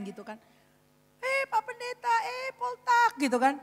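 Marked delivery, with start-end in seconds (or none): 1.2-3.2 high-pitched delighted hello; exclaimed, high-pitched meeting of acquaintances; hearty greeting. Acted. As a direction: call out a greeting in a raised voice.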